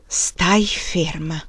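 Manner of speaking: slow, hushed whisper to oneself; whispered self-command, drawn out; inner thought. A narrator's reading. Woman alone in the cabin warning herself, whispered thought. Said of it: "pensò lei".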